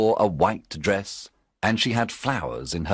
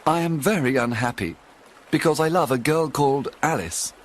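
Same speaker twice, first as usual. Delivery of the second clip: higher, lightly voiced sorrowful young man; unhappy Alan; 5 semitones higher than usual. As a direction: plead your story to Robin, sadly. Alan tells his sad tale.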